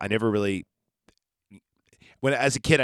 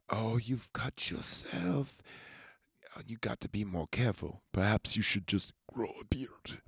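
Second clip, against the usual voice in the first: creepy soft Dracula; 8 dB quieter than usual. Retold or imitated. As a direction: purr it like a tempted vampire. Dracula eyes Harker's blood.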